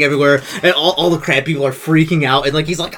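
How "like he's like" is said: usual speaking voice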